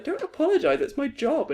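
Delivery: pitched well up, friendly insistence; high mock reassurance. Host retells telling a customer, high mock reassurance. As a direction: play yourself telling a customer not to apologise. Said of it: "I was like".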